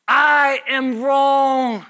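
very slow, stretched declaration; drawn-out confession. Shown as a demonstration. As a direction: stretch out each word emphatically.

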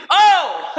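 loud, high exclamation; surprised oh. Real, not acted. Preacher reacting to a quitter, loud surprised. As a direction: burst out in disbelief.

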